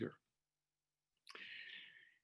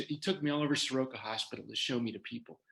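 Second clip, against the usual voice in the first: ordinary delivery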